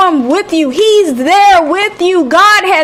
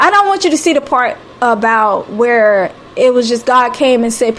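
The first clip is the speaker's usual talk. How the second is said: ordinary talk, nothing marked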